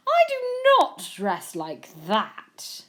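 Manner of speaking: slow, emphatic indignation; stuffy, drawn-out protest; offended exclamation. A narrator's reading. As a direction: deny it word by word, affronted. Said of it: "Jenkins exclaimed".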